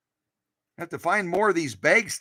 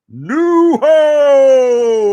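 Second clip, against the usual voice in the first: announcer; 8 semitones higher, 12 dB louder than usual. Acted. Proudly announcing the machine brand.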